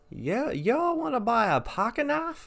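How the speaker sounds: very high, slow put-on voice; mocking homeless man. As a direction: drag out his pitch in a squeaky caricature. Homeless man's sales pitch, retold.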